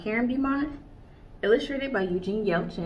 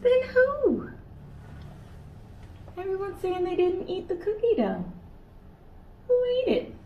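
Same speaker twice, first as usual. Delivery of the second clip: much higher, baffled questioning; puzzled kangaroo; 10 semitones higher than usual. Acted. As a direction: sound baffled and curious.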